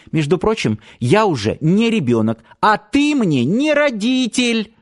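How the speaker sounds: high, rising defiance; angry retort. A narrator's reading. Girl lashes out at grandfather. (запальчиво, всё выше и выше)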